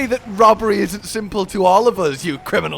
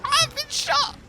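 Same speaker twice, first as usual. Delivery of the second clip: high, thin, comic wheeze; squeaky dying voice; 3 dB quieter, slower than usual. Shown as a demonstration. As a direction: squeak out your last words. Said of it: "Why would that be my dying voice?".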